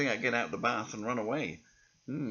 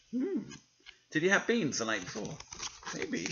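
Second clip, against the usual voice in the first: ordinary delivery, nothing marked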